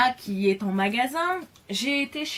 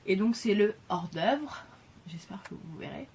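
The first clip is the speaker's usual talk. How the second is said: usual speaking voice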